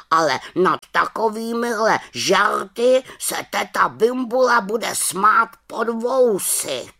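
high, loud huffy raven voice; offended raven. A narrator's reading. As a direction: warn her huffily, drawing out the ending. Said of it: "urazil se Abraxas". (uražený havran, vysokým hlasem)

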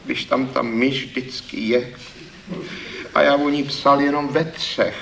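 put-on mild downcast voice of the young thief; dejected. A narrator's reading. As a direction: shrug it off, crestfallen. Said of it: "Pravil hlas malomyslně".

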